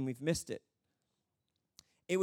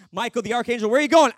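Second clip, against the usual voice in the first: loud, raised call after someone; mock alarmed call; 5 semitones higher, 14 dB louder, faster than usual. Archangel calling to spirits leaving heaven, mock alarm. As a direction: holler after them as they rush off.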